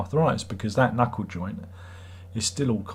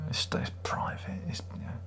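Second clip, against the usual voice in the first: hushed confiding whisper; 12 dB quieter than usual. Real, not acted. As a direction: drop to a secretive whisper. Health is private, hushed.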